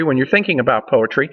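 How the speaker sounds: ordinary talk, nothing marked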